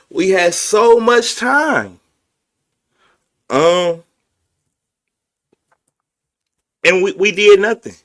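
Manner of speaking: stretching the words in exasperation. Real, not acted. Frustrated rant about silence.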